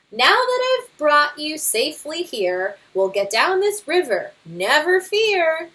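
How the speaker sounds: boastful Papa Bear. Acted. Father bear brushing off the scouts' guidebook, boastful. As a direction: scoff at the book with bravado.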